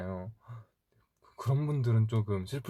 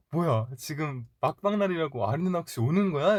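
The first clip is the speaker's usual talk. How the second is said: higher, playfully teasing reenactment; 6 semitones higher, 3 dB louder than usual. Retold or imitated. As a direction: act out rushing over to tease a friend. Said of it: "놀리려고 딱 봤는데". (놀리듯 높은 톤으로)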